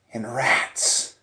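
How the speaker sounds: ordinary delivery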